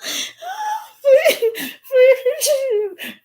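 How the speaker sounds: long shrieking laugh. Real, not acted. Laughing fit.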